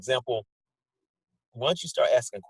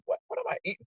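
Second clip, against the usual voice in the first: squeaky high disbelief at herself; high-pitched mock shock at her own snacking; shocked self-question; 6 dB quieter, faster than usual. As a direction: catch yourself mid-bite, startled. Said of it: "and I was like".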